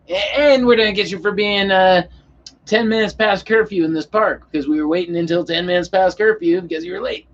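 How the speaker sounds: high, mocking cop voice; mocked cop. Mocking the cop adding a charge.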